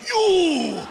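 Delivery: Hitler's mock outraged yell on seeing him; angry recognition. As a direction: shout it as you spot him.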